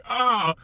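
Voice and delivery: high, loud "ah" of alarm; imitated distressed yelp; acted panicked cry. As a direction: cry out at the sight of blood. Reacting to blood spraying, acted out.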